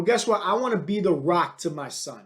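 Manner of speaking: usual speaking voice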